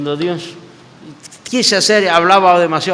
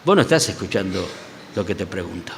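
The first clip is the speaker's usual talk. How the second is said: gentle rebuke; 7 semitones lower, 6 dB quieter than usual. Retold or imitated.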